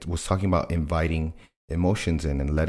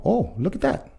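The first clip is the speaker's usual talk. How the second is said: high, softer pleasant surprise; mock surprise; 12 semitones higher, 4 dB quieter than usual. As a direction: discover it with light delight. Pleased inner voice.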